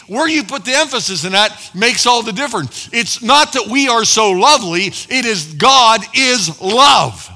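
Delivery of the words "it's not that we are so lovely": ordinary delivery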